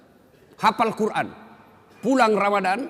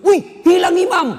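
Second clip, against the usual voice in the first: very high, very loud cry of alarm; startled shout; 13 dB louder than usual. Retold or imitated. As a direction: act out a boy yelling in sudden shock. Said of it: "Langsung teriak".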